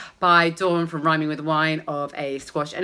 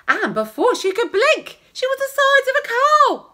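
rising excitement; 6 dB louder than usual. Acted. Exaggerated building narration.

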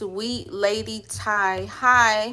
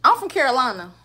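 Southern country accent; 4 semitones higher, 9 dB louder than usual. Real, not acted. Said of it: "You can hear my country accent".

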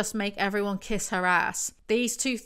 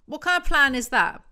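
exasperated rant; 6 semitones higher, 5 dB louder than usual. Real, not acted. Ranting at character's plan.

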